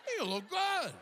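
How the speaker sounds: very high, squealed mock astonishment; exaggerated surprise. Comic exaggerates how people compliment him, squealed disbelief. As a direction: leap way up as if you can't believe it. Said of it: "they say it with a kind of surprise".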